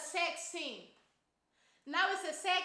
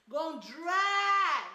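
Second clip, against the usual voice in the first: drawn-out shout; 6 dB louder, much slower than usual. Real, not acted. Storyteller's outraged emphasis.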